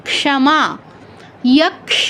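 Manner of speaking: ক্ষমা and যক্ষ with k-sha, no kh; Sanskrit ksha demo. Shown as a demonstration. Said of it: "এরকম".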